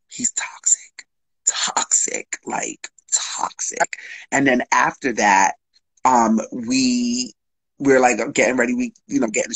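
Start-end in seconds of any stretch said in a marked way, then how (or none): 0.1-4.0 hushed whisper, slowing at the end; soft conspiratorial whisper. Real, not acted. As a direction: breathe it out like a secret. Whispered aside about lover.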